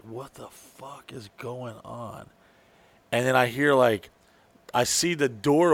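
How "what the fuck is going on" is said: slow, barely audible muttering; hushed disbelief. Retold or imitated. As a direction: draw it out under your breath, baffled. Baffled reaction to more yelling.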